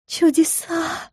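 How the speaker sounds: soft, high, drawn-out wonder; hushed, long-drawn gasp of delight; rapturous moan. A narrator's reading. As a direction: moan it slowly in awe. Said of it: "простонала Карина с восторгом".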